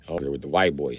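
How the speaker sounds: ordinary delivery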